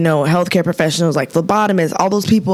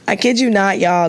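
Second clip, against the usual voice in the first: ordinary delivery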